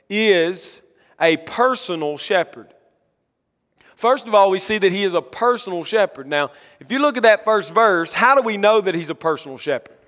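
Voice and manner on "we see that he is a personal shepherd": ordinary delivery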